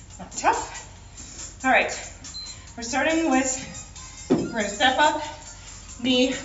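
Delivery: ordinary talk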